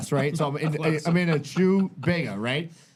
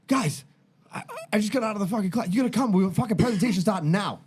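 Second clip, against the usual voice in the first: higher, rushed, panicky classmate voice; frantic classmate; 7 semitones higher than usual. Retold or imitated. Classmate waking them late for the presentation, frantic. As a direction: hurry them out of bed in a panic.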